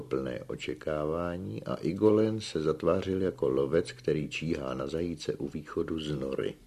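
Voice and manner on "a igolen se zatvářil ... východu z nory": plain reading voice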